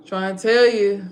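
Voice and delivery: frustrated near-shout at the listener. Real, not acted. Repeats line, second time louder.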